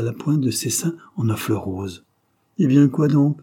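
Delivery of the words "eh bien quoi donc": louder, higher irritated exclamation; displeased cry. A narrator's reading. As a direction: call it out, annoyed and abrupt. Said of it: "cria Claude, mécontent".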